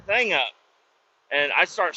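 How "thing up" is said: higher, mock-bossy order; hammed-up bossy coworker. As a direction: play the wannabe boss barking an order. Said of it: "he's like".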